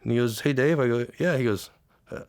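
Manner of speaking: high voice, halting as emotion takes over; real lump in the throat, breaking off; choked up. Real, not acted. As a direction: struggle to get the words out without crying. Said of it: "I don't know why I get like this".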